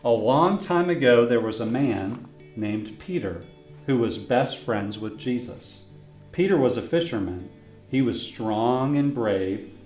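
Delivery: plain reading voice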